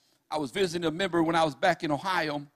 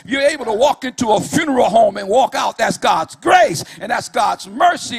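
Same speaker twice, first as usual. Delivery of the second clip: whooping preacher at full force; full-force preaching; 3 semitones higher, 8 dB louder than usual. Acted. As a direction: preach it hard, riding the music. Preaching climax over music.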